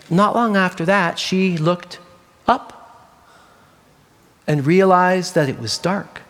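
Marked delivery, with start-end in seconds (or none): none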